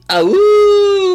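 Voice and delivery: high, loud, squeaky attempt at a wolf howl; failed wolf howl. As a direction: howl like a wolf but come out squeaky like a little rabbit. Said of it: "I did not sound like a wolf".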